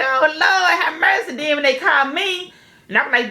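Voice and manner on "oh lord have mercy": laughing exclamation. Retold or imitated. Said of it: "I'm like".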